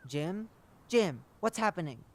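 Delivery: loud worried calling over the radio; alarmed. Acted. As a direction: call out to him in alarm.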